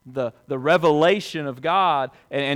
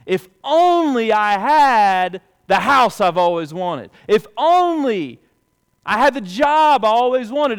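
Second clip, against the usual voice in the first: loud, higher, pleading wishes; mock longing lament; 9 semitones higher, 10 dB louder than usual. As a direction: cry out each "if only" like a wishful believer. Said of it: "And it goes like this".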